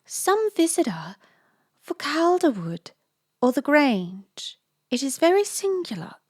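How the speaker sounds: put-on high, wondering old lady voice; puzzled old lady. A narrator's reading.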